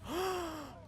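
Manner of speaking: sharp high gasp of delight. Acted. Friend gasps on seeing the rare gift. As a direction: catch your breath at the sight of it.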